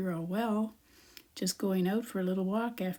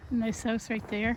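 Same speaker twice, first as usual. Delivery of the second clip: ordinary talk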